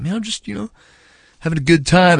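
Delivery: mock a caught roadie playing it cool; stoned roadie. Roadie caught with a joint, answering the angry bandleader in a high stoner voice. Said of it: "The guy's like".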